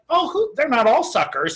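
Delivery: high-pitched opening, then dropping; imitated objection, swooping down from high; mock naive protest. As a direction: start high as a protesting character. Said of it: "and she's like".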